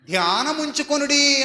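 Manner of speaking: deliberate, stately reading of scripture; solemn scripture reading. Acted. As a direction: intone the verse slowly, building at the end. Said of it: "అంటున్నాడు బైబిల్లో".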